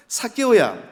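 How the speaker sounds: high, loud Gyeongsang call; Gyeongsang dialect. Shown as a demonstration. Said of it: "경상도 말로 한번 표현해보면".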